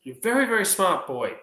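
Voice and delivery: high schoolteacher voice; acted teacher's approval; teacher praising. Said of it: "She goes".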